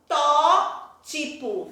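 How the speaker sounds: "Protótipo" drawn out very slowly, syllable by syllable. Shown as a demonstration. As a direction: break "Protótipo" into slow, separate syllables. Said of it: "Todas as proparoxítonas são acentuadas". ("Protótipo" bem devagar, sílaba por sílaba)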